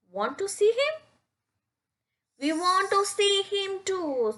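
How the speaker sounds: high voiced critters; small animals. Snake and dragonflies want to see, high. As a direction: lift way up for little creatures.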